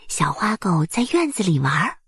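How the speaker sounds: usual speaking voice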